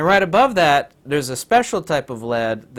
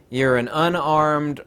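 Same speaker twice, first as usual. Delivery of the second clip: usual speaking voice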